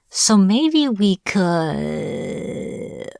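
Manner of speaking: "could" stretched long, trailing away; hesitant bargaining. Acted. Captive witch bargains with captor, hesitant and drawn out. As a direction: drag out the last word as if fishing for a deal.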